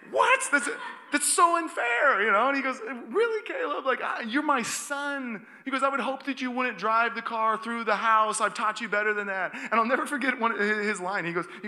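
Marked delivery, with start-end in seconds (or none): 0.1-2.2 high, loud, drawn-out whine of injustice; mock outraged protest. Teen's retold protest to dad. 3.1-5.5 high, loud fatherly disbelief; retold incredulous dad. Dad voiced in incredulous reply. 5.8-9.5 high, loud, exasperated dad; retold fatherly scolding. Dad voiced chiding his son.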